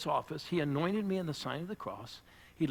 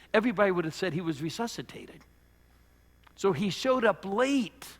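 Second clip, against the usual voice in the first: preaching at full force; 5 semitones higher, 6 dB louder than usual. Real, not acted. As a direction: drive it home to the congregation. Preaching climax on Lazarus.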